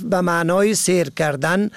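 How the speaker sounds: normal talking voice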